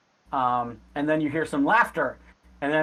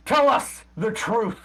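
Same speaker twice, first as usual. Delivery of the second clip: slow, raised-pitch demand; demanding interrogator; 7 semitones higher, 4 dB louder than usual. Acted. Interrogator demands truth from the beaten beggar, forceful. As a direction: press hard on each word.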